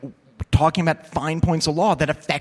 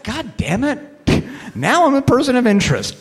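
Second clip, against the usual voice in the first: high-pitched mock exasperation, louder as it goes; exasperated; 9 semitones higher, 4 dB louder than usual. Speaker realizing he's now a suspect, exasperated. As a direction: curse your luck in a raised, high voice.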